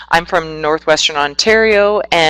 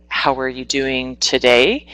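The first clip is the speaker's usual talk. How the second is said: a demo of how a question rises; rising question tune; 4 semitones lower, 3 dB quieter than usual. Shown as a demonstration. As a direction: pitch climbs at the end of the question. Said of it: "our voice tends to go up".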